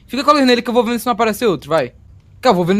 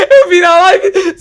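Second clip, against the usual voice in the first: high, laughing panic cry; scared shout; 8 semitones higher, 6 dB louder, much slower than usual. Real, not acted. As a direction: yell it, half laughing from the scare. Susto, grita rindo. (grito assustado rindo)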